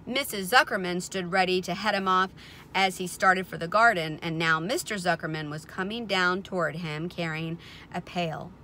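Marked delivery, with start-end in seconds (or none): none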